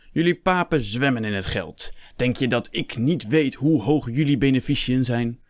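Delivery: fired-up accusation with punched words; angry shouting. A narrator's reading. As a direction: rail at them in acted anger. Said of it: "schreeuwde Hector".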